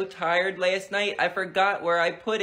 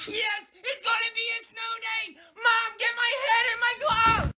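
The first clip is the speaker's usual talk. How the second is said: put-on excited kid yell. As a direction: wake the house for a snow day. Kid yelling about snow day.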